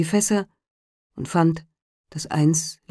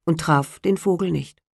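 usual speaking voice

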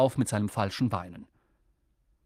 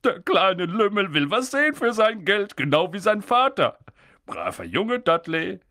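proud, fond uncle voice; hearty, indulgent father; chuckling uncle; 6 semitones higher, 4 dB louder than usual. A narrator's reading. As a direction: voice Uncle Vernon pleased with his son. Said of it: "Onkel Vernon gluckste".

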